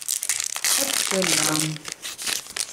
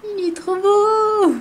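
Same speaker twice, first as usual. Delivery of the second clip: high cooing voice; 13 semitones higher than usual. Real, not acted. Cooing over a cute card.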